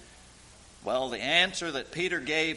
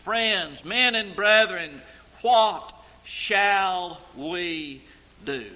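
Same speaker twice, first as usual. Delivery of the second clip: loud, slow cry of "Men and brethren, what shall we do?"; anguished cry; 5 semitones higher, 9 dB louder, slower than usual. Acted. Crowd crying out to Peter, loud anguished plea. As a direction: call it out like a stricken crowd.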